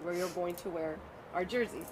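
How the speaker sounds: ordinary talk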